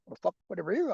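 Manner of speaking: fast taunting Aussie voice; cheeky kangaroo picking a fight; mock Aussie roo. Kangaroo taunting a passerby, light mock Aussie.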